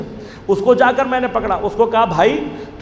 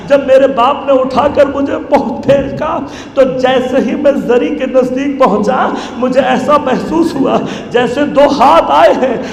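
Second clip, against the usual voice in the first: ordinary talk, nothing marked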